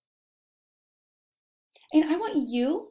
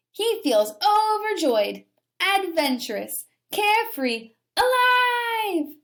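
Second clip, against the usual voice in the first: rising higher and louder with joy; joyful build; 8 semitones higher, 3 dB louder than usual. A narrator's reading. Reading aloud as the squirrel glides, building excitement. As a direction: let the joy climb to the top.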